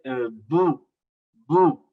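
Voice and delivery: bu with the lips pressed, a firm voiced b; lip sound b. Shown as a demonstration. Preacher showing the congregation the lips b sound. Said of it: "con los labios".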